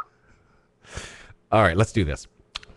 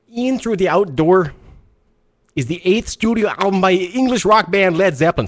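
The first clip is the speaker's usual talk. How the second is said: attempted Mexican Canadian accent; 8 semitones higher than usual. Host restarting his accent bit for listeners, stiff foreign-sounding accent. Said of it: "Sounds like [name] from Metallica".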